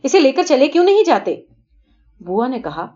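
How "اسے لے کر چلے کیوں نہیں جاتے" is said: sharp, loud rebuke; scolding. A narrator's reading. Father scolding Prakash to take the boy, loud. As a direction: snap the order at him. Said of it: "میں نے ڈانٹ کر کہا".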